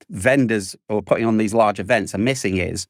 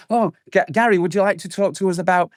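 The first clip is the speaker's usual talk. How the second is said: higher, fast mock vendor pitch; eager vendor; 6 semitones higher than usual. Guest imitates conference vendors calling Gary over, eager and higher. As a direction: play a keen booth rep grabbing a passer-by. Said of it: "they're gonna go".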